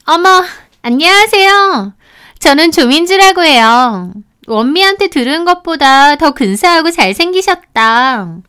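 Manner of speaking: high, delighted greeting with swooping pitch; gushing flirty friend.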